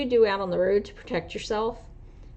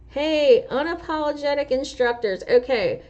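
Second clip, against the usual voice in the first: calling out; 7 semitones higher, 5 dB louder than usual. Real, not acted. Calling out to the channel.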